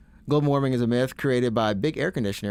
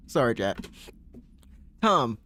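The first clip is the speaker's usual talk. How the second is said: usual speaking voice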